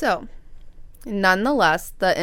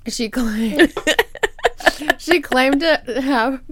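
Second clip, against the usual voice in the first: laughing; 8 semitones higher, 6 dB louder, slower than usual. Real, not acted. Laughing after the motorcycle joke.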